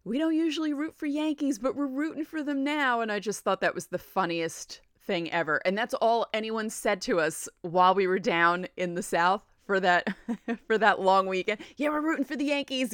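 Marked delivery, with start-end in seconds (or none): 0.0-3.0 higher-pitched imitation of a local lady; lifted voice for a stranger's friendly remark; restaurant lady's voice. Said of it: "you know, she said". 11.8-12.9 higher put-on voice of the locals; lightly mocking, high-pitched quote with a laugh behind it; imitated Southern locals. Voices the Southerners' remark again.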